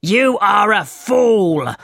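high, slow, drawn-out storybook outburst; angry cry. A narrator's reading. Leader turning on the thief, angry cry. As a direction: stretch out the insult in angry exclamation. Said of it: "became more and more angry".

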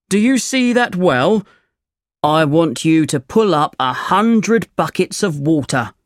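lightly voiced demanding farmer. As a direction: set terms as a hard-bargaining farmer.

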